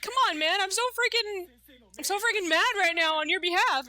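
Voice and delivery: hammed-up indignation for a friend; mock anger. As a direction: vent it for your friend, half joking. Friend angry on his behalf.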